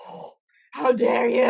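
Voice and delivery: loud, hammed-up cry of offence; mock outrage. Friend retelling the drama, mock indignant shout. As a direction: play it as over-the-top wounded pride.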